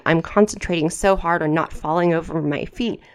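ordinary delivery

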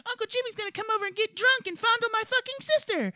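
very high, squeaky mocking voice; high cartoon voice mocking small talk; mock chatter of shoppers. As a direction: squeak it out like a babbling crowd. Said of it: "People are talking".

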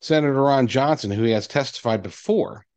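ordinary talk, nothing marked

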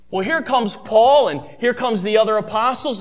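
normal talking voice

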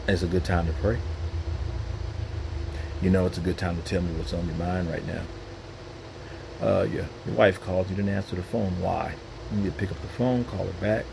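low, soft, gentle voice of God; voicing God. God gently nudging the believer to pray, low and soft. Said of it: "when God says".

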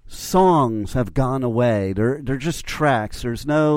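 normal talking voice

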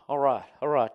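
punched, lightly put-on showman's voice. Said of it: "doing the [name] thing".

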